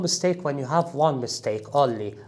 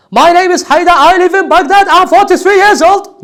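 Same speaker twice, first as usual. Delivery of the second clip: loud, much higher, announcer-style self-introduction; mock broadcast announcer reading; overexcited announcer; 13 semitones higher, 9 dB louder than usual. Shown as a demonstration. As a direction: belt it out like a hyped sports commentator. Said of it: "you are not a commentator".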